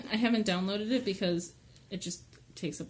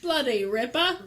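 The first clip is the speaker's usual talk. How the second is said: put-on Australian accent; 3 semitones higher, 3 dB louder than usual. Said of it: "start speaking Australian again".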